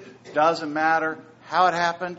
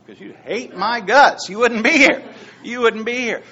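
loud laughing exclaim; 7 semitones higher, 11 dB louder than usual. Real, not acted. Joking he'd have ruined business.

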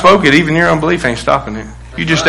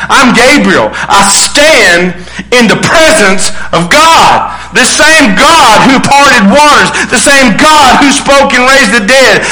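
indignant angel, building to high-pitched declaration; Gabriel with attitude; 7 semitones higher than usual. Acted. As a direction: pull rank as God's offended messenger. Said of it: "if he said it with attitude".

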